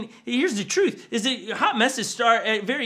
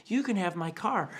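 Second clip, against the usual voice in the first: soft offer; 8 dB quieter than usual. Retold or imitated. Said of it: "that's Greatness doing that".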